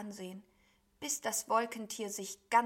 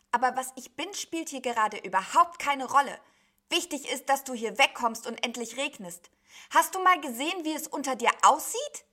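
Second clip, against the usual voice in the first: raised, louder, impatient telling-off; indignant scolding; 5 semitones higher, 10 dB louder than usual. A narrator's reading. Sheep tells cloud beast to look below, indignantly scolding. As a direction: scold with mounting volume and pitch.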